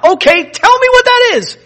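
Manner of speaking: high-pitched, loud impatient demand; insistent demand. Acted. Eager listener pressing for the meaning of the mystery, insistent demand.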